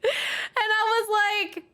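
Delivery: very high, slow squeal through laughter; laughing disbelief. Real, not acted. Telling friends about the refund customer, laughing incredulously. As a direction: crack up in outraged amusement.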